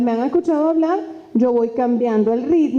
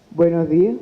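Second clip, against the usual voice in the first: stiff greeting; 7 semitones lower than usual. Shown as a demonstration. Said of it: "si yo me paro aquí firme".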